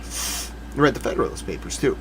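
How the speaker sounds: whispered aside. Real, not acted. Smug aside.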